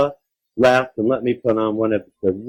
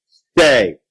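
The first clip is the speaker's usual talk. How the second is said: raised, loud one-word order; creature's command; 4 semitones higher, 5 dB louder than usual. A narrator's reading. Creature orders the child to stop, commanding. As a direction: give a firm monster command. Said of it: "the creature commanded".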